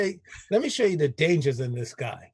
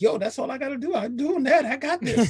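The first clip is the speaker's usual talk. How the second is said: higher, breezy show-off voice with a laugh; mock cocky; 8 semitones higher than usual. Host imitating cohost Rob bragging, mock cocky. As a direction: toss it off like nothing's hard. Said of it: "Rob Nice is like".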